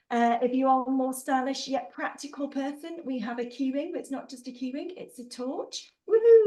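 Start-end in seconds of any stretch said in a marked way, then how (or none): 6.1-6.5 loud, high-pitched whooping; excited cheer. Real, not acted. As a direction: whoop it up for the prizes. Cheering the prizes she shows off.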